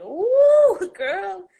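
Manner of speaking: a sudden high, loud ooh of shock, then a laugh. Retold or imitated. Hearing the friend's news, a shocked high squeal.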